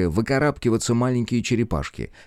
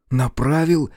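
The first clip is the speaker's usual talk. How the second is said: high, puzzled question; surprised; 7 semitones higher, 4 dB louder, slower than usual. A narrator's reading. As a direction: ask it in disbelief. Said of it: "с удивлением спросил мальчик".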